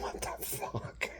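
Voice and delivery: slow, breathless whisper of disbelief; stunned whisper. Retold or imitated. Reacting to his wife's mockery, a slow stunned whisper. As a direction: mouth it in stunned silence. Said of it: "I'm like".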